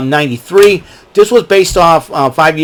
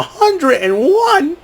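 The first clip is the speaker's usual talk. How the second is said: singsong title; much slower than usual. Acted. Announcing the movie's number.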